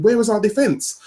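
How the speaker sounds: retold scream. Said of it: "I was screaming".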